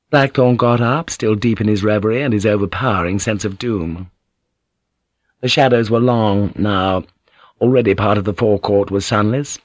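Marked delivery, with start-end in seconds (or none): none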